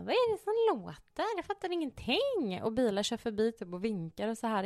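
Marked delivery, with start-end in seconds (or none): 0.0-2.6 mycket högt och ljust undrande; förvirrad. Retold or imitated. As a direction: spela bortkommen och naiv. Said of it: "Jag bara". (ljust förvirrat prat i bilen)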